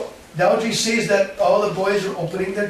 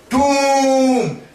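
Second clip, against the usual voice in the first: horn boom; 8 semitones higher, 6 dB louder than usual. Retold or imitated. Balaram blows his horn.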